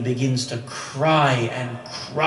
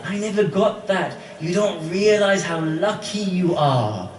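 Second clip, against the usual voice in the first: louder, higher preaching at full force; impassioned preaching; 4 semitones higher, 3 dB louder than usual. Acted. Preacher retelling Uwais and the companions, impassioned. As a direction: pour out the story with rising fervour.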